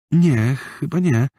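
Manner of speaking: put-on a shy girl unsure; little girl's voice. Girl answers. (wysoki, powolny głos dziewczynki)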